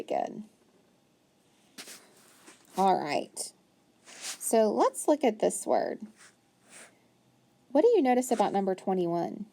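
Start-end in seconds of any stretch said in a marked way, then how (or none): none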